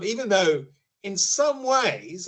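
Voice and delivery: "some" lifted high and drawn out; stress on some. Shown as a demonstration. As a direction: lean hard on "some", slowly. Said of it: "and I emphasize the word some".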